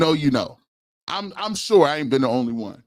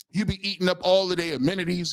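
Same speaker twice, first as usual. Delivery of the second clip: ordinary talk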